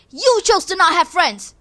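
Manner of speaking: mock scolding yell; snapping back at the loner; retold yell. Classmates scolding her for having no friends, mock yell. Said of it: "they will always yell at me".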